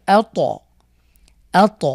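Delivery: ত released with a loud bounce; আ, ত with ত jolted; qalqalah on ত. Shown as a demonstration. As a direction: punch the ত after আ. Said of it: "তকে যদি আমরা সুকুন দিয়ে পড়ি".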